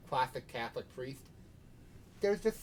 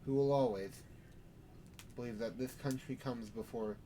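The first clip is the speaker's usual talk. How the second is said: sermon; 5 dB quieter than usual. Acted. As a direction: preach it with measured pauses. Sheriff preaching to vampires.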